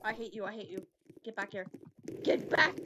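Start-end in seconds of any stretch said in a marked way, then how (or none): 2.2-2.7 louder, higher call; sudden shout. Real, not acted. Player chasing someone, sudden louder shout. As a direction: yell it at someone fleeing.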